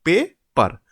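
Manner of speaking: paper split as pay, per, with a clear pause; two separate beats in paper; broken into syllables. Shown as a demonstration. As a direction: break paper after the first syllable. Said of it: "We break it here".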